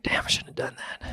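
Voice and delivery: a rueful whisper, voice gone; whispered regret. Retold or imitated. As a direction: whisper it to yourself, full of regret. Whispered regret about losing temper.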